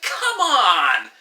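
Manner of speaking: exasperated plea. Retold or imitated. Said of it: "It's like".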